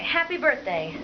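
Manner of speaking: usual speaking voice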